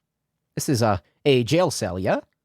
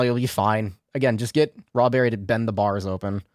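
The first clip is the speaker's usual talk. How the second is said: ordinary talk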